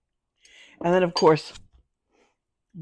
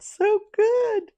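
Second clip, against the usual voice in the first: delighted. Real, not acted. Tasting the dish, delighted.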